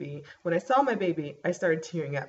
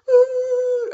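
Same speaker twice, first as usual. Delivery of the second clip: high, loud crying wail, much higher; ugly cry; 12 dB louder than usual. Retold or imitated. Reliving the heartbeat on the sonogram, a high loud crying wail. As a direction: wail it out like happy tears breaking through. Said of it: "I was like".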